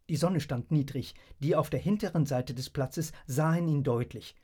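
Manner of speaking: ordinary delivery, nothing marked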